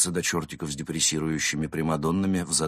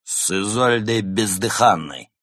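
slow, higher, louder boast; proud; 4 semitones higher, 6 dB louder, slower than usual. Acted. Tipsy cat revealing his famous drinking companion, proud. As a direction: announce the name grandly. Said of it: "гордо произнёс кот".

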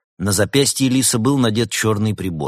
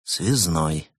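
sighing. A narrator's reading. Улис confirming he is a time liaison, resigned sigh. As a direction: admit it with a mild sigh. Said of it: "вздохнул Улис".

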